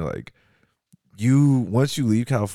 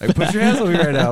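high, loud retold dare; mock challenge; 8 semitones higher, 7 dB louder than usual. Tour mate daring the bodyguard to grab him, loud mock challenge. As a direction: goad him loudly, pitched way up.

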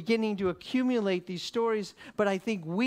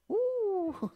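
high-pitched imitated "ooh"; mock a bystander's high coo of unease; startled onlooker's ooh. Onlookers react to crying man.

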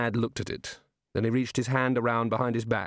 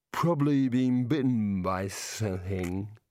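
put-on drunk mumble; slower than usual. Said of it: "said Rincewind".

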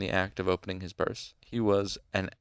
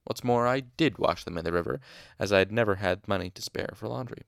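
ordinary delivery

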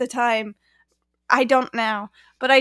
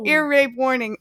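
normal talking voice

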